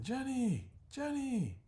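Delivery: loud, very high desperate calls; calling out. Acted. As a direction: shout her name into the smoke. Reggie searching through smoke.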